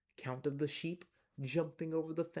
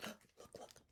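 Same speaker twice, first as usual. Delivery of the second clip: hushed whisper; 20 dB quieter than usual. A narrator's reading. Whispered after gasp.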